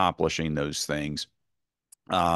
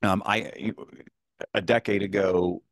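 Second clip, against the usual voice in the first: ordinary talk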